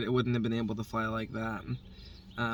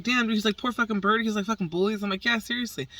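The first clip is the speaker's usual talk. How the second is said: high, animated back-and-forth; lively retold chat; 7 semitones higher, faster than usual. Neighbor and her about the bullied bird, high and animated. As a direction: keep it up and animated.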